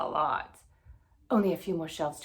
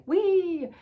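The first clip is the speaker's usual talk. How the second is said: much higher, much louder whoop; gleeful whee; 12 semitones higher, 10 dB louder than usual. Acted. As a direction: whoop as you leap high. Jumping joyfully in new body.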